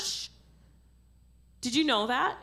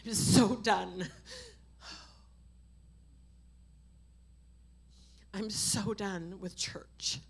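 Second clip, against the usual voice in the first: slow, low, drained near-whisper; weary, hushed confession; 4 semitones lower, 7 dB quieter, slower than usual. Real, not acted. Preacher confessing she is done with church, hushed. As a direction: sink into a spent hush.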